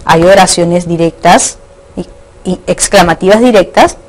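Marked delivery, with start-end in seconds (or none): none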